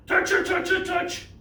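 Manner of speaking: high, squeaky little chant; rapid-fire squeaky coaxing; playful urging. Pretend. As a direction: urge them on in a tiny high voice. Playful urging to touch the floor.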